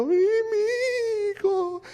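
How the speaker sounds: high, slow, hammed-up wail of a weeping mother; mock wailing. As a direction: wail it like la Llorona, far over the top. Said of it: "se fue llorando". (llanto exagerado y agudo de madre, como la Llorona)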